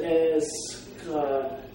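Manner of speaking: Eskatos very slow, syllables spread apart; slow sounding out. Shown as a demonstration. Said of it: "say it a couple times".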